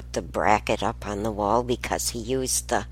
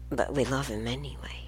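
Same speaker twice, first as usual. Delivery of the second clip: soft whispered confession; hushed aside; 9 dB quieter, faster than usual. Real, not acted. Mother whispering about her son's bad habit, hushed. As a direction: whisper it fondly, like a family secret.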